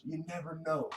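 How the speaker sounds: playful ominous aside to viewers; mysterious tease. Shown as a demonstration. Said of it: "You never know, guys".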